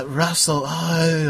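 put-on failed Australian accent. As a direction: badly fake an Australian accent. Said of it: "I can't do an Australian accent".

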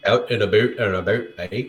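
low Canadian-style "out and about"; low voice, Canadian "aboot" vowels; mock Canadian accent. Teasing a Canadian friend, mock Canadian accent. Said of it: "makes fun of your guys' accent".

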